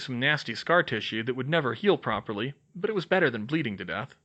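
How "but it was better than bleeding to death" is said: usual speaking voice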